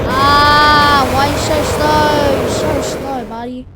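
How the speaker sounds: loud mocking yell at the monster; loud, mocking jeer at a slow chaser; taunting shout. Real, not acted.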